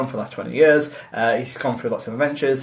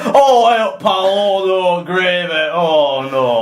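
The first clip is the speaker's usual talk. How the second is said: put-on broad Yorkshire accent; 3 semitones higher, 4 dB louder, slower than usual. Mocking his own old self in a louder, hammed-up Yorkshire accent. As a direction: caricature a thick Yorkshire lad. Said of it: "full blown Yorkshire accent".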